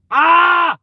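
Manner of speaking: sharp, loud, high cry; pained yelp. Real, not acted. Tugging own hair to prove it's real, sharp yelp. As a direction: yelp as the hair gets tugged.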